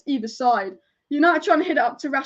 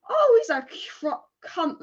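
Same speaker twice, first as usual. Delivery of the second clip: exasperated cry; 5 semitones higher, much slower than usual. Real, not acted. Raging at the referee's call.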